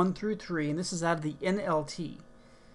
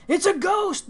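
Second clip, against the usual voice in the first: high, loud frightened cry; 11 semitones higher, 6 dB louder than usual. A narrator's reading. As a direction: cry it out in fear. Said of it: "cried out in fear".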